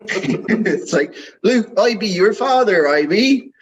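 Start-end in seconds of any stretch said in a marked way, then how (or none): 1.4-3.5 mock West Country burr, much higher; West Country accent. Host joking how the line would sound from Bristol, in a hammed-up West Country accent. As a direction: play the Vader line as a rustic West Country lad. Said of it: "in a West Country accent".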